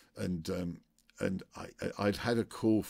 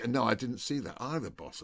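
ordinary talk

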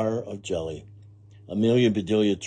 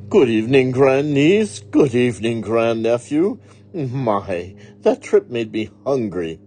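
great aunt's greeting; 4 semitones higher, 7 dB louder than usual. Acted. Great aunt greets relatives.

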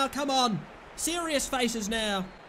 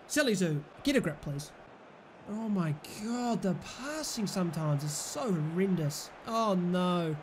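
ordinary delivery

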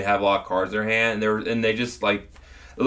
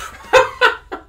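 sudden high breathy laugh; burst of laughter; 9 dB louder than usual. Real, not acted. Friend cracks up at the bidet joke, laughing.

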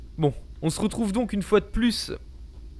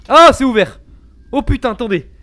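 loud startled cry; sudden surprised shout; panicking gamer; 6 semitones higher, 12 dB louder than usual. Real, not acted. Startled by door.

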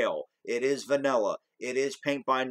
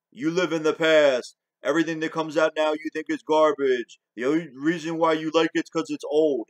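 louder, sneering voice for the other side; mocking their argument; 3 semitones higher, 5 dB louder than usual. Ranting vlogger mimics movie fans, louder and sneering. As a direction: throw their line back at them mockingly. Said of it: "the whole argument of, you know".

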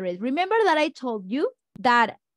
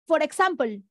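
for example with a Spanish accent, tapped r and eksampol; 3 semitones higher, faster than usual. Shown as a demonstration. Teacher demonstrating a learner's Spanish accent on for example. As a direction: say it like a Spanish-speaking learner would. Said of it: "it's not an example".

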